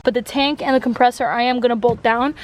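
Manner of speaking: normal talking voice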